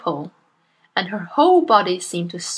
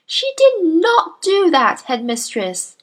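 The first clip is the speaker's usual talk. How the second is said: put-on higher, earnest young teacher voice; Miss Honey; 9 semitones higher, 5 dB louder, slower than usual. Young teacher protests to her headmistress, earnest and higher. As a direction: politely protest to a bully.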